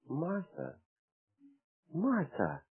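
very soft, tender calling of the name; gently. Acted. The Lord gently calls Martha by name, very soft. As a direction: say her name softly, like a gentle rebuke. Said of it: "And the Lord said so gently".

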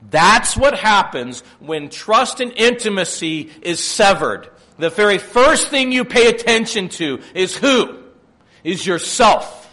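loud, high, driving sermon delivery; full-force preaching. Acted. Preacher warning the church about selfishness, booming. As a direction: preach it hard at the congregation.

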